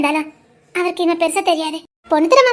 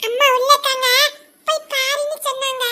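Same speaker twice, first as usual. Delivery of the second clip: put-on child voice; 7 semitones higher than usual. Child voice answering.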